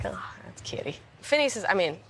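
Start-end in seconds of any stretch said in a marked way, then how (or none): 0.0-1.0 soft fond whisper. Real, not acted. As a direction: whisper it tenderly. Fond aside about brother.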